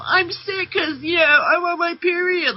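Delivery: mock a weak woman whining about her period; hammed-up tearful whine; mocking whiny complainer. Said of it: "like a, like a pussy".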